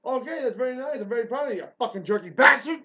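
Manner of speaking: mock-congratulate him, then explode; a furious mocking shout; sarcastic yell. Real, not acted. Sarcastic rant at rude man.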